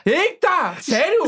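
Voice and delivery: high-pitched astonished reaction; excited surprise. Real, not acted. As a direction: react in happy disbelief. Reacting to [name] announcement.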